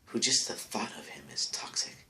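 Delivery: unvoiced sneering whisper. Real, not acted. Jabbing at his neighbor under his breath, whispering. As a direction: drop to a whisper for the jab.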